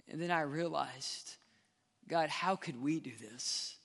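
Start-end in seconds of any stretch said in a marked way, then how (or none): 2.1-3.8 low, soft, chastened prayer; hushed and low, confessing; humbled realization. Retold or imitated. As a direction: ask it quietly, turning the blame inward. Humbled realization after his angry prayer.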